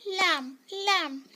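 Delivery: लैम्प called out loud, b silent; loud repeated drill. Shown as a demonstration. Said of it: "repeat करती हूँ".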